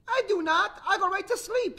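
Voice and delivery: put-on very high, loud child's retort; arguing little sister. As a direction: protest shrilly like an accused little girl. Story sister argues back.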